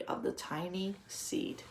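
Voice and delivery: hushed whisper to start the story. Real, not acted. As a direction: lean in and whisper it like a secret. Teacher starting story for children.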